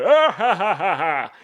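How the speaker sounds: loud, high, hammed-up belly laugh; big put-on "ha ha ha", starting high and loud; mocking laugh. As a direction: imitate a wrestler laughing at a caller. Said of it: "just laughing at Bobby".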